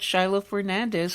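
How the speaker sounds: ordinary delivery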